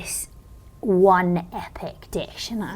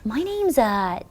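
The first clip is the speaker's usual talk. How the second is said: put-on American accent; 2 semitones higher, 4 dB quieter than usual. Nervous caller ordering a secret special, fake American accent. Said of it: "I put on this accent".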